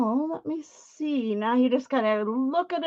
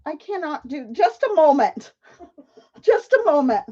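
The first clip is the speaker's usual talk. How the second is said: higher, louder startled plea; 4 semitones higher, 4 dB louder than usual. Real, not acted. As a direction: blurt it out, flustered and half laughing. Startled by spider, calls out.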